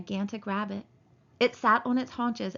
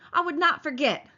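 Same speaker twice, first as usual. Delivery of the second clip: higher, louder insistence; Lenny insisting, raising his voice; a loud, high denial; loud protest; 7 semitones higher, 6 dB louder than usual. A narrator's reading. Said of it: "Lenny said loudly".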